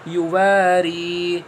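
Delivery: یواری stretched slowly, each sound held; slow blended word. Shown as a demonstration. Teacher blending یواری for students, slow. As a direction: draw out یواری. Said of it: "اب رواں کیسے پڑھیں گے آپ؟".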